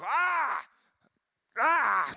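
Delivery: high mock yell of wrath; hammed-up "ah!" while hurling lightning bolts; acting out an angry God, pitch leaping up. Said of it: "it's like God just gets angry".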